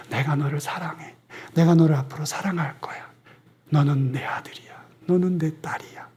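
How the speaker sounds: put-on soft whisper, like a father to his child; God whispering to his children; whispered love. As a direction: breathe the promise tenderly. God's tender words to children.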